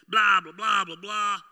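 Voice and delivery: loud dismissive blah blah; mock blah blah. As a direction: wave off the noise, loud and fed up. Mocking chatter while irritated.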